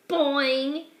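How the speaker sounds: usual speaking voice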